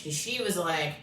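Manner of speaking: usual speaking voice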